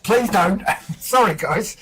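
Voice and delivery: helpless laughing apology; laughing plea. Real, not acted. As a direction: beg them off while laughing. Laughing plea after partner eggs on.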